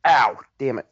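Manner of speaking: sudden pained yelp; real ouch from a stubbed toe. Real, not acted. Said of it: "I stubbed my toe".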